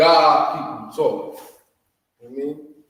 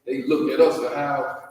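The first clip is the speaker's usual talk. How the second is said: ordinary talk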